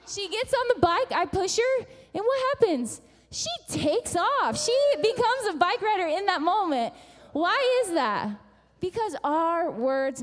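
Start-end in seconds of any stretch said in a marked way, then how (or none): 0.1-8.3 high, loud, excited telling; triumphant climax. Real, not acted. Preacher tells how the child took off, triumphant. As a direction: build to the payoff, exultant. Climax of her bike story.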